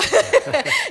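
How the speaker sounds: sudden high laugh; real laugh after imitation; high burst of laughter. Real, not acted. Laughing at her own imitation.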